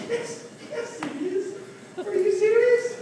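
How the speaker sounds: very high incredulous squeak; squeaky disbelief. Retold or imitated. Teller answers parent, high squeal.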